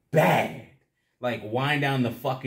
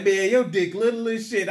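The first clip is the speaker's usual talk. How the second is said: mocking friend; 9 semitones higher, 4 dB louder than usual. Friend Boone's insult quoted.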